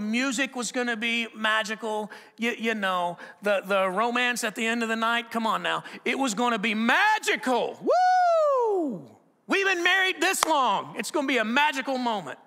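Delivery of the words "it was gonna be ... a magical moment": loud, high hyped-up excitement; mock-excited husband whooping; mock hype. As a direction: oversell the big night like a cheer. Hyped-up anniversary plans.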